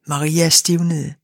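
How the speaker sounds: plain reading voice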